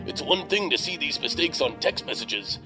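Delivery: ordinary talk, nothing marked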